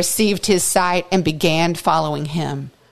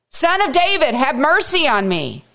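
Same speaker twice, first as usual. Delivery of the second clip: higher pleading call; crying out all the more; 6 semitones higher than usual. Acted. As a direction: call out to him, pitch up, begging. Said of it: "he dared to cry out all the more".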